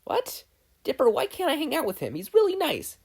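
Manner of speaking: indignant little sister defending her friend; high pleading Mabel. Acted. Mabel voiced again.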